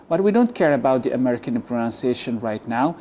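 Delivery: ordinary talk, nothing marked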